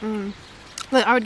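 talking with mouth full; mumbling through food; chewing. Real, not acted. Seen 1: Woman bit sandwich at -4; lips pursed, jaw working early on.

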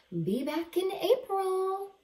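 high, lightly voiced mother goose; Mother Goose's note. Mother Goose leaving a note for Bruce, breezy and high.

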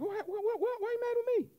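high squeaky what what what; mock bewildered protest. Preacher mimicking a confused covenant breaker, high and bewildered. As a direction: play the baffled one who broke the deal. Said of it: "You're like".

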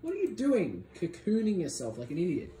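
ordinary talk

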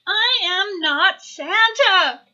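high, loud, slow denial; exasperated little owl crying out; loud protesting cry. A narrator's reading. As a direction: cry out, drawing out every word. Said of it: "cried Baby Owl".

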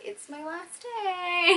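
slow high quote. Retold or imitated. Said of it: "She's like".

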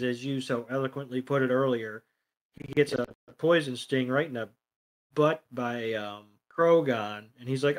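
usual speaking voice

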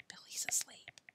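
very soft whisper. A narrator's reading. Alan telling his father about sleeping Billy, whispering. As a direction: whisper so as not to wake him. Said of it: "whispered Alan to his father".

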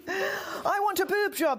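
high, slow mock yell; mock shout. A narrator's reading. Woman pipes up at town meeting, mock shouting. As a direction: wail it out high and drawn out. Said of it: "shouted Missus Droopy Tits".

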